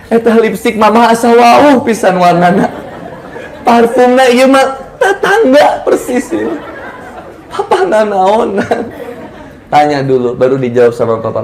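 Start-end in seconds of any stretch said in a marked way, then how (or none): 0.1-8.9 high-pitched hammed-up jealous outburst; drawn-out, melodramatic Sundanese scolding; mock angry wife. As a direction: accuse him in comic high-pitched outrage. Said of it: "jangan langsung".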